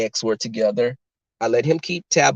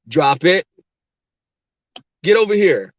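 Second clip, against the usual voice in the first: louder, forceful commands; alpha voice; 4 semitones higher, 6 dB louder than usual. Retold or imitated. Alpha owner ordering the schnauzer over, commanding voice. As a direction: summon the dog like the boss. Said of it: "when I started going deeper".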